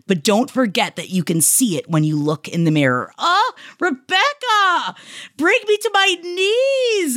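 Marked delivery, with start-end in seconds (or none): 3.2-7.2 overwhelmed. Real, not acted. Overwhelmed by a listener's praise.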